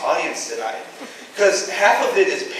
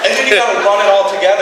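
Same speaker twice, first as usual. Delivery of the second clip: ordinary talk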